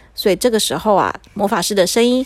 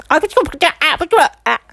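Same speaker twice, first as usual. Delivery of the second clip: high-pitched nonsense babble; alien gibberish; 5 semitones higher, faster than usual. Acted. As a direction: babble incomprehensible alien talk. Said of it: "他说了一串他自己都听不懂的话".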